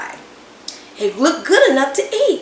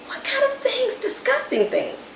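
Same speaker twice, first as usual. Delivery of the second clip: high unvoiced whisper; scheming whisper; 14 semitones higher, 6 dB quieter than usual. A narrator's reading. Boy plots disgusting pie ingredients, whispering. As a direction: whisper it like a plotting kid.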